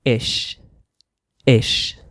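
short, isolated "ish" ending; "ish" as one short syllable, sh held; "ish" sound. Shown as a demonstration. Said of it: "you say".

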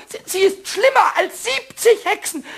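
furious outburst. Acted. Pumuckl ranting to Eder about the witch, angry shout. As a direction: spit out how awful she is. Kobold raging about the housekeeper.

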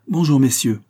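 loud public greeting; calling out. A narrator's reading. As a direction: hail the gathered group loudly. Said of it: "cria-t-il".

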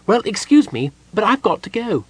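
high-pitched kender voice, edging away; apologetic kender. A narrator's reading. Kender inching away from the angry gnome, apologetic. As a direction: excuse yourself in a high voice.

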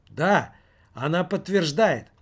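high, loud happy relief; relieved employee rejoicing; joyful. Acted. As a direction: announce the good news gladly. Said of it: "радостно проговорил".

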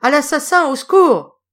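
loud, high-pitched shout of alarm; cry for help. A narrator's reading. Victim attacked in the dark street, high shouted cry for help. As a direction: cry out for help against a killer. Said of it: "un cri se fit entendre".

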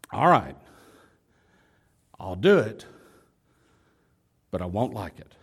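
low, halting resignation; grudging acted consent; reluctant husband. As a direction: give in low, with long pauses.

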